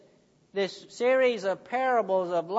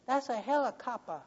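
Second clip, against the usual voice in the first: put-on quick soft child's whisper, a bit higher; 4 semitones higher, 7 dB quieter, faster than usual. A narrator's reading. Child whispering to the boss about the noise. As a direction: hush it like a little kid. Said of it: "The little whisper".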